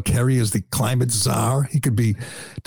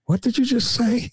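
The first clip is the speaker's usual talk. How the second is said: mock incredulous; 12 semitones higher than usual. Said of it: "the guy's just looking at her going".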